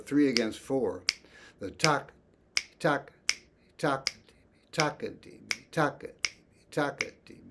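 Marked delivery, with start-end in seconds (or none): 1.6-7.4 tak punched higher at the start of each Takadimi; accented downbeats. Shown as a demonstration. Teacher showing three against four, accenting each tak. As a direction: hit the first tak of Takadimi hard and high. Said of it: "you really hear that accent".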